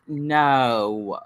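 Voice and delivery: low, drawn-out no; deadpan refusal. Retold or imitated. Answering an offer to take afterbirth home, low deadpan refusal.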